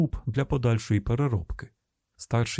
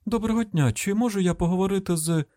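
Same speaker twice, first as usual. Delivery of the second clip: high-pitched, polite phone enquiry; polite caller; 8 semitones higher than usual. Acted. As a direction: ask politely in a much higher voice.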